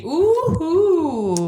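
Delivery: thrilled high ooh; ooh of excitement. Real, not acted. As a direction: squeal at the drama. Reacting to dramatic scene.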